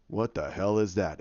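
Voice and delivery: low villain voice, slightly softened; Thanos. Retold or imitated. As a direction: say it low, as a baffled warlord. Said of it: "you have Thanos go".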